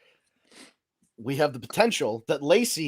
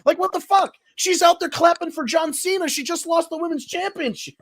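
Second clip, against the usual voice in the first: outraged; 12 semitones higher, 5 dB louder than usual. Real, not acted. Outraged rant about the wrestler.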